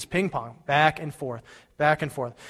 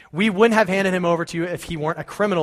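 retort; 5 semitones higher, 7 dB louder than usual. Acted. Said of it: "they retorted".

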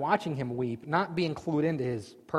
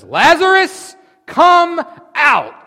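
huge, high shouted command; shouting a dead man out of the tomb; 14 semitones higher, 16 dB louder, much slower than usual. Acted. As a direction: bellow it into the grave. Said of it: "Then Jesus shouted".

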